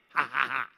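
loud, forced sarcastic "ha ha ha"; hammed-up fake laugh, spaced out; mock laugh. Sarcastic Jesus capping his joke, mock laughter.

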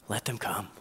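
soft, low, tender command; hushed, low welcome; gentle invitation. Acted. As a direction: say it quietly and reassuringly. Jesus gently welcoming the children.

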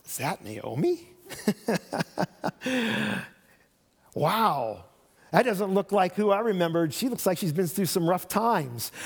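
sneering, with a mocking laugh; scornful gossip. Shown as a demonstration. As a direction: snicker at how worn she looks. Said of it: "a lot can be in tone".

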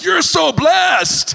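high, loud, stretched-out cry of wonder; amazed neighbor. Retold or imitated. Saudi neighbor exclaims.

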